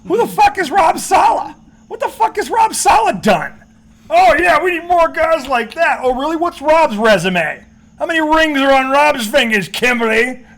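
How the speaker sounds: loud, high-pitched mock outrage; imitating incredulous rant. Radio pundit going at Kimberly over Rob Saleh, mock outrage. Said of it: "Mad Dog's looking at her going".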